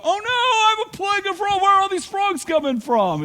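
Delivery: high, fast squeaky panic voice; mock panic. Preacher voicing an Israelite panicking over frogs, high mock panic. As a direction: play a frantic Israelite overrun by frogs.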